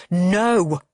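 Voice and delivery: a loud barked refusal; thundering no. A narrator's reading. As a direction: slam the word down like thunder. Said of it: "thunders Frank".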